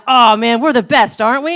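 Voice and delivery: loud, high, smug teasing; gloating tease. Retold or imitated. To the troll, loud high smug taunt. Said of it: "having fun with it".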